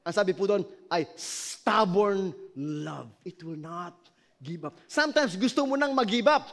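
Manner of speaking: loud, driving sermon voice; fiery preaching. Acted. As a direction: preach it hard to the congregation. Pastor preaching at full force.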